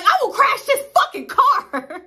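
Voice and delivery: hammed-up angry yell; mock threat shout. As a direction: yell it half-joking. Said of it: "I was like".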